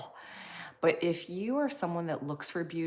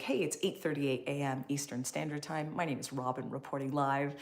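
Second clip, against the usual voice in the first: normal talking voice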